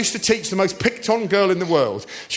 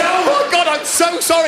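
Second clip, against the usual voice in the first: high, loud flustered apology; panicked apology; 11 semitones higher, 4 dB louder than usual. Retold or imitated. Teacher apologising to pupil, high and panicked. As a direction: blurt it out in panic. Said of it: "I said".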